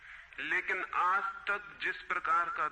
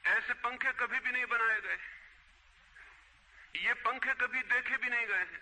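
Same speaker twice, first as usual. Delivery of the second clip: mild, higher-pitched mock street cry; retold hawker's cry; 5 semitones higher than usual. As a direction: imitate a fan seller calling out his wares, half-raised voice. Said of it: "जोर से चिल्ला रहा था". (फेरीवाले की हल्की नकली पुकार)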